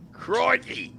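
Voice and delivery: high mock cry of "Crikey."; Irwin's crikey. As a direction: toss off a high, hammed-up "Crikey.". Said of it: "he would have gone".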